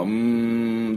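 うーん as a sustained "mmm"; うーん held like pondering; long M sound. Shown as a demonstration. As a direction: hum うーん through closed lips. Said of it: "で、Mの音は".

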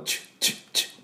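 チュッ as short, vowelless "ch" clicks; clipped, separate チュッ sounds; bare T release. Shown as a demonstration. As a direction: release just the consonant of チュッ. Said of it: "この音を出しただけで終わり".

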